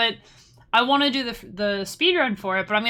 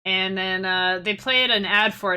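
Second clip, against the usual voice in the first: usual speaking voice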